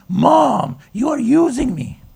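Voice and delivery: put-on loud, high wail of a four-year-old; imitated child howling. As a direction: howl it in protest like an upset little boy. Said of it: "he starts howling".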